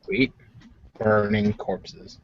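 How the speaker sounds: ordinary delivery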